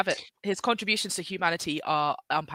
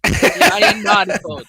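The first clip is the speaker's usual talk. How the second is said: the Voice; 4 semitones higher, 10 dB louder than usual. Pretend. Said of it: "I'm sad that you didn't read the name of the paper in, in The Voice".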